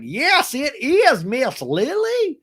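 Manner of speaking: high, loud, slow put-on drawl; mock saloon host. [name] answering Heather, in a high put-on drawl.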